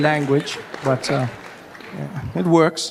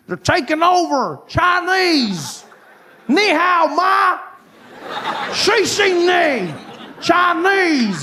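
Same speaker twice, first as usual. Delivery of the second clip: high, slow panicky warning; mock alarmist; 11 semitones higher, slower than usual. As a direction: ham up a doomsayer urging Chinese. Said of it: "I see people say".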